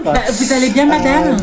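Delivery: loud, overbearing mom voice; loud pushy mother; loud mother character. Acted. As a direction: play the pushy mother booming over everyone.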